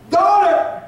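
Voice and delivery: high, projected call. Acted. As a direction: call out to her, pitched well up. Voices Jesus calling the woman.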